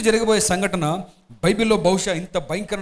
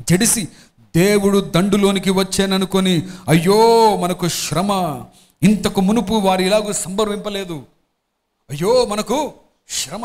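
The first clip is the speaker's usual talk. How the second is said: slow, louder lament in the reading; scripture reading; 5 dB louder, slower than usual. Acted. Pastor reading the Philistines' lament, slow and loud. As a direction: draw out the woe, heavy and slow. Pastor reads Bible passage aloud.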